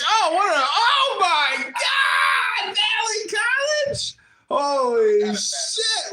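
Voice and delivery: over-the-top fake amazement at the college; mock astonishment. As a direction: ham up the shock to ridicule him. Mocking Steve's Valley College.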